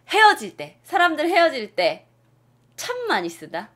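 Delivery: usual speaking voice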